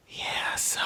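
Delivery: soft ASMR whisper; full whisper. Retold or imitated. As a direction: breathe the words like an ASMR video. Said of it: "I don't like the full whisper".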